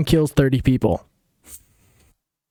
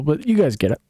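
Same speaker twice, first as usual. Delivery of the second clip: ordinary talk, nothing marked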